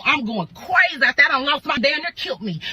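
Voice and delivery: usual speaking voice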